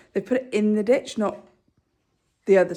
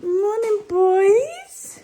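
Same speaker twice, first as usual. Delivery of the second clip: much higher, louder sing-song greeting to the horses; high pet talk; 12 semitones higher, 8 dB louder, much slower than usual. Real, not acted. Greeting her horses in stable.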